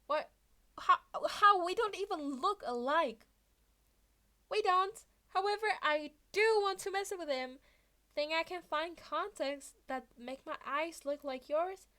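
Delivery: high and loud, bursting with mischief; giddy kid hatching a prank; gleeful scheming. Acted. Izuku gleeful, planning prank.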